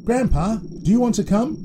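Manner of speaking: put-on high child's invitation; boy asking grandpa. A narrator's reading. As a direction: ask hopefully as a young boy.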